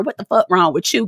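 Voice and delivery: ordinary talk, nothing marked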